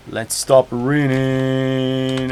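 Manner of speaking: very slow "raining" on a long held note; drawn-out. Real, not acted. Streamer stopping the rain in game, long drawn-out delivery. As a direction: stretch out the end of "raining".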